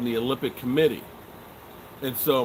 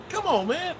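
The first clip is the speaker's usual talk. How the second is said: very high incredulous plea; mock protest. Host retells protesting an insult, high mock disbelief. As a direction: protest in a squeaky, pitched-up voice. Retells own shocked reaction.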